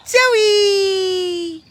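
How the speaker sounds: high excited shout to the dog; excited call. Real, not acted. Greeting the dog loudly.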